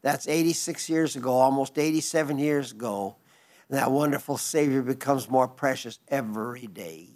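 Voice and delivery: ordinary delivery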